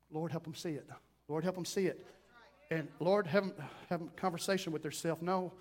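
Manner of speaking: low, soft pleading prayer under the breath; hushed intercession; murmured prayer. Acted. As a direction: pray quietly for them, almost to yourself.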